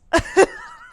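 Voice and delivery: high shrieking laugh; nervous high squeal. Real, not acted. Nervous laugh at race joke.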